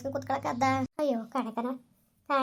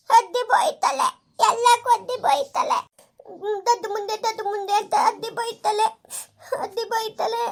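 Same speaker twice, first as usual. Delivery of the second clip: put-on high, slow, louder child complaint; whiny little girl; 8 semitones higher, 3 dB louder, slower than usual. Daughter complains to grandma that aunt scolds her, high slow child voice. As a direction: drag out the words like a sulking child.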